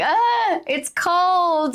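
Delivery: high-pitched grumbling groan; annoyed whine. Retold or imitated. Mother grumbling inwardly while her son climbs, annoyed whine. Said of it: "feel annoyed".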